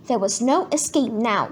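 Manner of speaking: usual speaking voice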